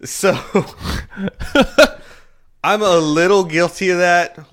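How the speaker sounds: high, loud giggle into the confession; laughing admission. Real, not acted. Confessing a bad habit to his co-host, laughing. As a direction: laugh it off, embarrassed.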